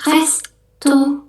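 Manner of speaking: "restoran" slow, French r as h; French pronunciation. Shown as a demonstration. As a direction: model "restoran" slowly. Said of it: "cuman kita bacanya". (ucapkan "restoran" ala Prancis)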